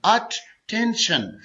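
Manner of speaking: Attention very slowly, अ-टें-शन; syllable by syllable. Shown as a demonstration. Teacher demonstrating a three-syllable word, attention slowly split in three. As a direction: pull attention apart into three beats. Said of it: "ये three syllable word है".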